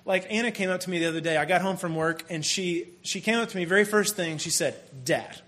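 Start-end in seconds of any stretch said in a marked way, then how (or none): 5.0-5.4 daughter's question. Retold or imitated.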